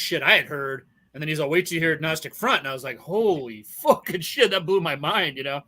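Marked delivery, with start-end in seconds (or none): none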